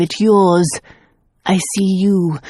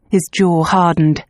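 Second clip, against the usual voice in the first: ordinary delivery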